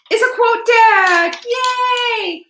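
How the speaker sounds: high, loud, drawn-out giveaway reveal; excited cheer. Real, not acted. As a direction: cheer the prize like a game-show host. Announcing giveaway prize.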